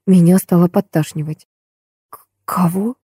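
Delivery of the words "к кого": soft, stammered, squeezed-out question; whispered. A narrator's reading. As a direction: barely get it out, stammering softly. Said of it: "шёпотом выдавила я из себя". (шёпотом, с запинкой)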